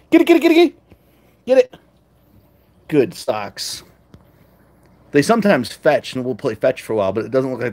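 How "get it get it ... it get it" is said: high, loud urging; excited call to cats. Acted. Owner urging her cats to fetch, excited. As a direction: cheer the cats on to chase.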